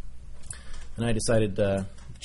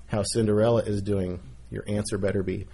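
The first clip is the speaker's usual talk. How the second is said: normal talking voice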